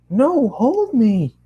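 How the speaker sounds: insistent Twilight. Acted.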